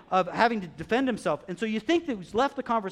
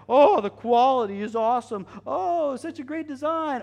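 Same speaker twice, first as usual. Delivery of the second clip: high, mock-impressed gushing; hammed-up admiration; gushing shoppers; 8 semitones higher, 8 dB louder than usual. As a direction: coo over it like an awed fashionista. Mimics gushing shoe buyers.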